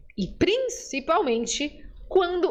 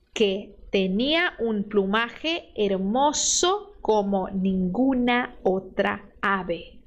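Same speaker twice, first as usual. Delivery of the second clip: slow, careful Spanish read for repeating; unhurried Spanish teacher reading aloud; slow model reading; 4 semitones lower, much slower than usual. Shown as a demonstration. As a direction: read it out slowly for students to copy. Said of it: "eu vou ler e você lê depois".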